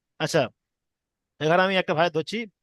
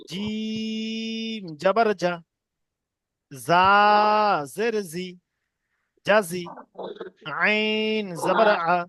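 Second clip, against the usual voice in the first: slow drill with long-held letter names; deliberate, chant-like spelling aloud; spelling piece by piece; much slower than usual. Shown as a demonstration. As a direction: stretch each letter, pause, then blend the syllables. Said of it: "কেটে কেটে বানান করবো".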